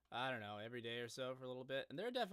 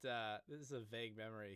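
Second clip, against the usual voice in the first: normal talking voice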